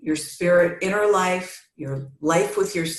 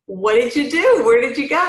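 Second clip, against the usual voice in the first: pitched up, louder, questioning; hammed-up demanding husband; mock demanding husband; 6 semitones higher, 5 dB louder than usual. Husband pressing his wife about where she went, mild mock anger.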